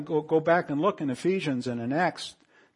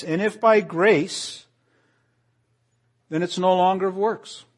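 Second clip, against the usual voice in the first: fervent preaching; 5 semitones higher, 8 dB louder than usual. Acted. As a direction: proclaim every phrase from the pulpit. Preaching scripture at full force.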